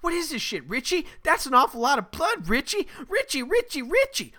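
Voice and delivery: high, increasingly loud mocking imitation; Marshall Bell. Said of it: "keeps saying Richie at the end".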